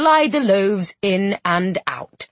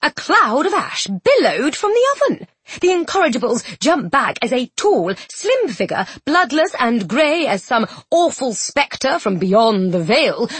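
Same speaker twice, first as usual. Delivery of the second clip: usual speaking voice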